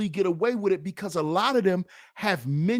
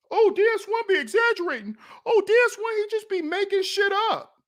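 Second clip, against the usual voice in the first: high, fast mocking whine of the doubters; mocking critics; 10 semitones higher, faster than usual. Commentator mimicking critics who say he exaggerates, high and fast. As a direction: parody the people who call him a liar.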